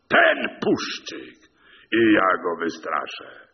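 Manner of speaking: slow, loud retort climbing high; high, forceful, deliberate taunting; defiant shout. A narrator's reading. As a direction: fire back indignantly, leaning on each phrase. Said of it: "krzyknął".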